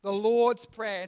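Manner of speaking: put-on slow, loud recitation for the kids to echo; slow group recital. Shown as a demonstration. Teacher to kids' church, slow and loud. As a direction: say it slowly so the class can follow. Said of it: "Can everyone say that?".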